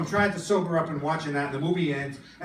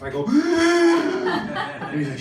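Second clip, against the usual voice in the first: dramatic pearl-clutching gasp; swishy gasp; 11 semitones higher, 4 dB louder, much slower than usual. Retold or imitated. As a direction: gasp like a scandalized diva.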